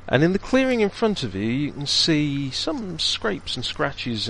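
slow, hushed scene-setting narration; game master scene setting. A narrator's reading. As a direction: paint the gloomy crypt slowly. GM describes the crypt scene.

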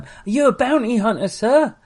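put-on high, fast child voice; excited kid voice. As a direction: gush like an awed little boy. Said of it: "little Moses runs up to Sam".

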